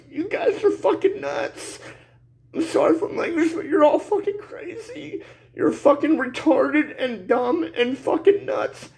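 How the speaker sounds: real sobbing plea, voice pitched high; crying. Real, not acted. Grandfather pleading for his grandchildren's future, crying. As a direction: wail through tears, halting between phrases. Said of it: "I'm crying like a bitch".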